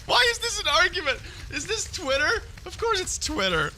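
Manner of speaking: high-pitched incredulous mockery; hammed-up high disbelief; mocking sarcasm. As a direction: ridicule it in a squeaky, exasperated voice.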